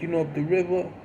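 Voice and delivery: as if to someone who knows no English; talking down. Retold or imitated. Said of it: "he would speak to me like if I didn't know English".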